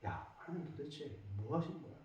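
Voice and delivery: very soft, low murmur of doubt; insinuating whisper. Acted. Unseen voice stirring doubt in a struggling believer, insinuating whisper. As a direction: lean in and whisper it, acting the tempter. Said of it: "누군가가 속삭여요".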